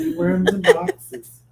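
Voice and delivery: high amused echo; amused repeat. Real, not acted. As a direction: toss it off with amusement. Laughing repeat of the phrase.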